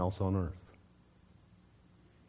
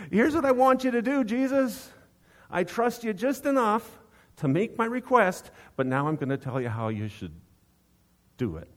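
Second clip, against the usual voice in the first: bossy prayer; 9 semitones higher than usual. Pretend. Believer bossing Jesus around in prayer, mock demanding. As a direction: pray like you're giving orders.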